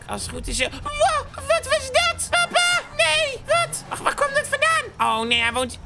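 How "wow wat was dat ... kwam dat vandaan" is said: very high, startled squeaky cries; startled squeak. Acted. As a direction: squeak out your shock at the bang. Explosion startles Henkie.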